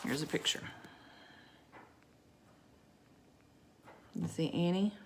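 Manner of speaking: quiet aside. Real, not acted. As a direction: show the picture quietly. Aside showing book to child.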